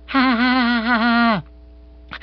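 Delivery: high, thin string of fake ha-has; badly faked nonchalant laughter; forced light laugh. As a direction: try a light laugh and fail. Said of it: "Bill tried a light laugh".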